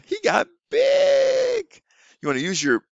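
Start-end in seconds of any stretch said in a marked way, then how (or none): 0.1-1.6 very high, slow and louder; excited teacher shout. Shown as a demonstration. Teacher acting out big for a young student, high and loud. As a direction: shout it big to get the child to act it out. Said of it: "You say".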